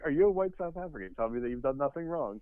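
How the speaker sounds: high, fast mock retort; comedian's comeback. Comedian snapping back at the airport clerk, high and fast. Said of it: "He's like".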